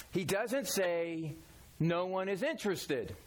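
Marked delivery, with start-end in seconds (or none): 0.1-1.3 slow, weighted "doesn't"; preacher stressing "doesn't". Shown as a demonstration. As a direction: lean hard on "doesn't", drawn out. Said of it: "He doesn't say, slowed".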